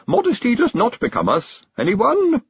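high-pitched hostess under strain; increasingly strained. A narrator's reading. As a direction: plead brightly, ending in a loud high call. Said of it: "getting more strained by the second".

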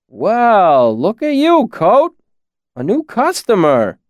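high, loud jovial ribbing; teasing villager. A narrator's reading. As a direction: rib him cheerfully. Jake teasing Coat.

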